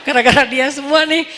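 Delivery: laughing through the words. Real, not acted. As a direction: chuckle it out. (sambil tertawa)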